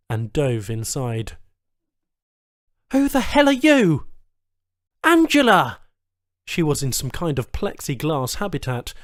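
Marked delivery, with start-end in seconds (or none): 2.9-4.1 high, loud startled demand. Acted. Captive woman startled by an intruder, loud high demand. 5.0-5.8 high loud cry of a name; shocked recognition. Acted. Man recognizing a lost woman, high loud cry.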